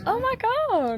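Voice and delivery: slow, high gasping oh my god; thrilled gasp at the seats; delighted gasp; gasped surprise. Real, not acted. Gasps at the reclining seats.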